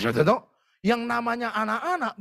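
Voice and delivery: normal talking voice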